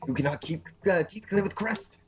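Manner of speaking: high put-on Japanese accent; mock Japanese dentist. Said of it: "in terms of the Japanese dentist".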